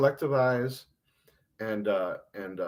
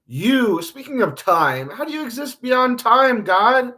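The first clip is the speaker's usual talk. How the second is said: loud, raised-pitch challenge; railing at God; 8 semitones higher, 5 dB louder than usual. Acted. Host confronts God about time, railing loudly. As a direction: demand answers from God, exasperated. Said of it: "Theo, stop railing against God".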